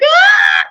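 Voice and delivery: very high, loud mock shriek; imitated hysterical wail. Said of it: "And then she's like".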